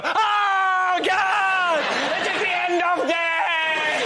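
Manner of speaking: high, slow panicked shout; hammed-up English doom cry; mock English panic. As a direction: shriek it like the world is ending. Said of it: "English people go".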